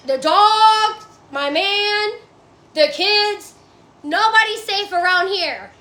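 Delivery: ordinary talk, nothing marked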